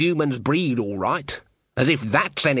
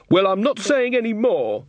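higher muffled voice behind a door; upset bride through a door; through a wall; 9 semitones higher than usual. Acted. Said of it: "the muffled voice behind the door".

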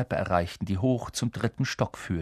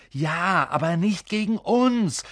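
exasperated, raised-pitch protest; exhausted; 9 semitones higher, 3 dB louder, slower than usual. A narrator's reading. Ron arguing with stubborn Neville, wearily exasperated. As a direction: object with worn-out patience. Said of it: "sagte Ron erschöpft".

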